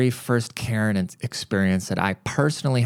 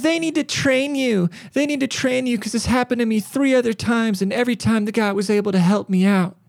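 mock entitled Karen; 11 semitones higher than usual. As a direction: demand the manager like an entitled old lady. Karen demanding better staff.

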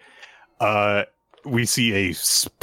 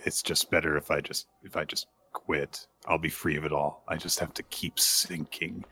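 soft, low despairing murmur; quiet despair; 5 semitones lower, 8 dB quieter than usual. Retold or imitated. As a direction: give up quietly, sinking. Despairing inner monologue.

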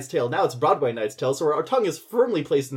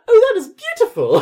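high, loud, gushing fake awe; mock swooning; 9 dB louder than usual. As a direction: squeal it like a lady mocking the priest. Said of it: "Jocelyn just takes the hand and is like".

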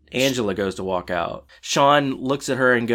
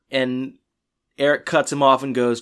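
ordinary delivery

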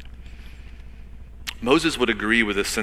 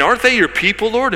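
bold pleading; 10 semitones higher, 6 dB louder than usual. Retold or imitated. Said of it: "boldly pleading with God".

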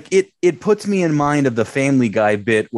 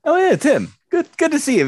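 mock cheery greeting; 12 semitones higher, faster than usual.

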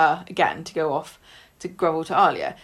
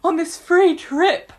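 high, louder hammed-up fishing tone; mock coy Ryan; 9 semitones higher, 5 dB louder than usual. Ryan fishing for Daisy, high hammed-up hint. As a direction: lay the hint on thick, half laughing. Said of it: "he's like".

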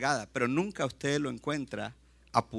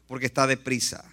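usual speaking voice

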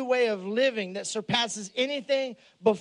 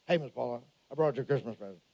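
trucker voice; 10 semitones lower, 5 dB quieter, faster than usual. Retold or imitated. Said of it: "He goes".

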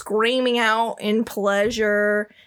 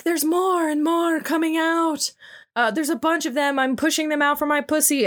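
put-on high, breathless eager girlish voice; high excited priestess; 7 semitones higher than usual. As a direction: gush it up high, rushing the end. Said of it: "And she's like".

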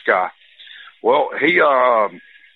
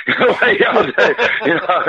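words through a high laugh; giggling through the words; 7 semitones higher than usual. Real, not acted. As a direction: laugh it out as you talk. Laughing after the punchline.

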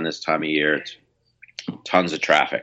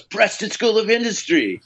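much higher, gushing fan naming the band; excited fan. Retold or imitated. Bartender thrilled to recognise the musician, higher gushing excitement. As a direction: blurt the band name like a starstruck fan. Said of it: "And he goes".

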